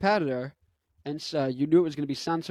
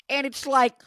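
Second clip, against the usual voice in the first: high, strained put-on lead-in; high caricature voice; Jordan Peterson impression; 9 semitones higher, 4 dB louder than usual. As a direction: pitch it up in professor mimicry. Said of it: "apparently Jordan Peterson doesn't either".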